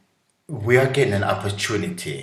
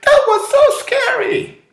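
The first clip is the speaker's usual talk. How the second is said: put-on very high, loud, childlike thrill; mock scared child; 7 dB louder than usual. Child stepping off a roller coaster, thrilled. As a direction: squeal it like a kid after a ride. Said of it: "And then they will say".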